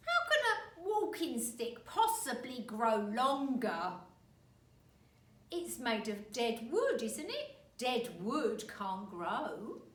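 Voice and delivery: slow, loud and scornful; scornful husband. Acted. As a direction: talk down to her. Husband scolds wife.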